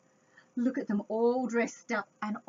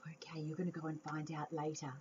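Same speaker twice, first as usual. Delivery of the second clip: whispered aside; 8 semitones lower, 11 dB quieter, faster than usual. Real, not acted. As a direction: hurry them along in a whisper. Aside to children.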